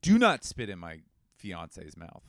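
stern warning. Real, not acted. Fiancé warns DM senders off his fiancée, loud and slow. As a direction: lay down the law, slowly. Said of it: "stern warning".

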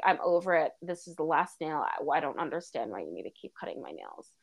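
lightly voiced weary dog, low and soft; a hint of a low, over-it dog voice; fed-up dog. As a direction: grumble quietly as a put-upon pet. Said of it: "She was just kinda like".

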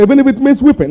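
high, pleading declaration; impassioned vow. Retold or imitated. Preacher quoting his old letter to the church, high and impassioned. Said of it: "But I said".

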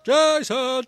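loud high shouting of a name; calling out Jason. Retold or imitated. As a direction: call Jason across the shopping centre. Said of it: "walking around going".

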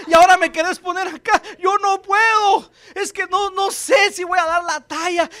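high, rising, panicky pleading voice; fearful Joshua. Retold or imitated. Pastor voices Joshua's fearful excuses to God. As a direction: whine in mounting fear.

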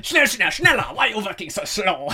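hammed-up German foreman shouting schnell; German accent. Cousin Dominic pushing workers to hurry, loud mock German accent. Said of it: "all in the German accent".

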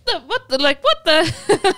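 high, stunned "what the", slowing down with a laugh; shocked disbelief. Retold or imitated. Retelling a crude pickup line to a podcast host, stunned high disbelief. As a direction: mimic your own jaw-drop, half laughing. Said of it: "And I was like".

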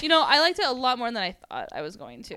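normal talking voice

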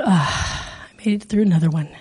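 low, sighed mock relief; relieved sigh. Son's relieved sigh after questioning.

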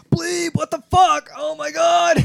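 high, loud, drawn-out yell; mock freak-out. Blob finds Bleeb peeing on him, mock freak-out. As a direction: act out a panicked protest to a friend. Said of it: "And starts freaking out".

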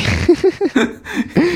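a rising high giggle; light high laughter. Real, not acted. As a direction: burst into a giggle. Laughing along.

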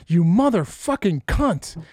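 high, slow furious outburst; imitated cursing tirade. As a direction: shriek the curses like a coworker losing it. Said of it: "some dude is just going off".

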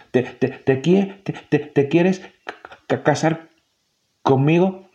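slow, high, halting stammer; the mouse stammering out a marriage proposal; nervous stammer. A narrator's reading. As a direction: stumble through the proposal, flustered. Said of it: "se le escaparon las palabras".

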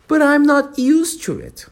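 put-on high pleading little girl; lightly voiced Alice pleading; piteous plea. A narrator's reading. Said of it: "pleaded poor Alice in a piteous tone".

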